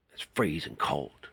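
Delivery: usual speaking voice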